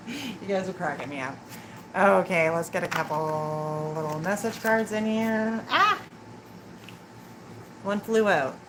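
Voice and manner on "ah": sudden high startled "Ah!" as a card flies out; a quick real jolt of surprise, much higher; surprised yelp. Real, not acted.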